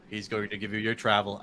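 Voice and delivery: low, even drone; monotone Tommy Dreamer. Retold or imitated. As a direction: keep it low and expressionless. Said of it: "talks in such a monotone voice".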